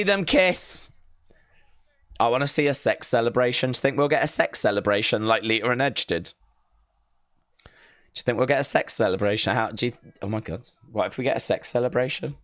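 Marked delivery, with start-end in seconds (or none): 2.2-12.4 giddy rambling. Real, not acted. Giddy rapid hushed chatter.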